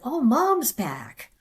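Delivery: high, slow, drawn-out exclamation; imitating a relative's delighted discovery; retold surprise. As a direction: announce an astonishing return. Imitating relative spotting her awake.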